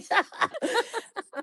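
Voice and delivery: laughing at the calamari comment; high laugh. Real, not acted. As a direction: burst into real laughter. Laughing after the apology.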